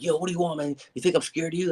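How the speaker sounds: loud, high-pitched, confrontational phone retort; defiant challenge. Retold or imitated. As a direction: challenge the caller, acting unafraid. Defiant phone retort to suspected enemy.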